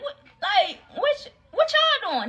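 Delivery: very high, half-laughing squeal of disbelief; shocked disbelief. Retold or imitated. To friends leaving her behind, high incredulous squeal. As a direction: squeak it out, can't believe they're ditching you.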